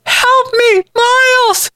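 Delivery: very high, frantic cry for help. A narrator's reading. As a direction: plead desperately at the top of your range. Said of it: "Allison called out frantically".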